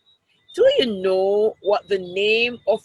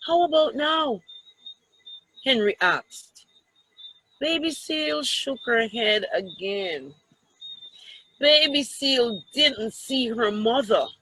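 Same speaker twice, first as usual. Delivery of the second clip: lively picture-book read-aloud; children's story voice. Acted. Teacher reads picture book to class.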